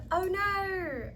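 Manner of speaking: loud, high mock "oh no" in the British friend's manner; mock dismay. Telling viewers how she copies cool people, mock dismay. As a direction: ham up the borrowed British exclaim. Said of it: "I'm like".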